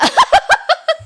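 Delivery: sharp high burst of laughter; real laughter. Real, not acted. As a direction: laugh at the absurdity. Laughing at own story.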